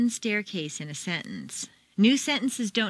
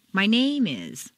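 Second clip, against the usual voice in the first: name stressed and drawn out, slower; 3 dB louder, slower than usual. Shown as a demonstration. Teacher contrasting stress, name emphasized. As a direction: lean on the word name. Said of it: "If you stress name".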